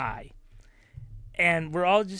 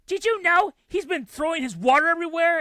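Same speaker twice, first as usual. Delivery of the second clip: high, louder exasperated tattling; indignant complaint; 11 semitones higher, 5 dB louder than usual. Retold or imitated. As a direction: complain it to the husband. Mom complaining to dad.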